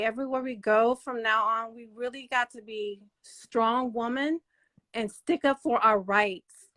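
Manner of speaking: ordinary talk, nothing marked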